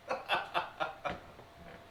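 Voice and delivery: mocking villain chuckle; gloating demon laugh. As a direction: laugh at the humans' expense. Demon relishes his revelation.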